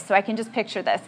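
ordinary talk

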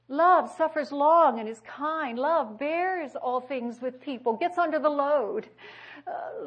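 slow, loud, ringing proclamation; preaching. Acted. Preacher proclaims the love passage loudly and slowly to the congregation. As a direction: declare each line of the love passage.

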